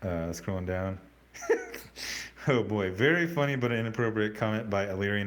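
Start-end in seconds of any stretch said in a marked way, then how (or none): 1.3-2.4 high burst of laughter. Real, not acted. Laughs at a chat comment.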